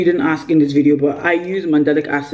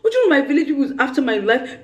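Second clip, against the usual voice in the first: laughing despair, higher; 7 semitones higher, faster than usual. Retold or imitated. To viewers, laughing harder at her own bad luck. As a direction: repeat it higher and faster with a laugh.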